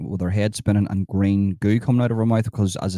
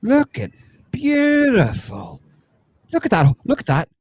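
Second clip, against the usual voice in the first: very high, stretched beautiful, then louder exclamations; gushing amazement; 4 dB louder than usual. Acted. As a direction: marvel at it in high, excited cries. Gushing over a sight on screen.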